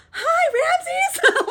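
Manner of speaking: very high, loud sing-out hello; sweet greeting. Retold or imitated. Alex spotting Ramses from the box, sweetly gleeful. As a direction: imitate an innocently delighted greeting. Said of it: "just being the sweetest person".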